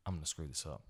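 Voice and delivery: low, soft fearful aside; quiet dread. Retold or imitated. Commentator fearing he'll fumble the name, low murmur. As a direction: murmur the doubt to yourself. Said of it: "I'm like".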